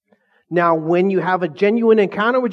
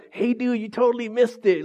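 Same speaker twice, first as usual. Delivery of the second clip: mock disciples; 6 semitones higher than usual. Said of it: "they're like".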